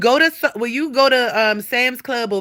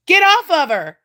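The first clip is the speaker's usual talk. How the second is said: louder, higher sharp command; scolding shout; 7 semitones higher, 6 dB louder than usual. Real, not acted. Owner yelling at the cat to get off her. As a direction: shoo the cat off hard.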